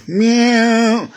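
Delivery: cat meow. Pretend. Toy cat voiced in play.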